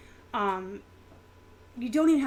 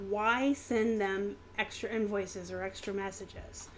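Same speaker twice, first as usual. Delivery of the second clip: ordinary talk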